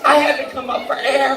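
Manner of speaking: usual speaking voice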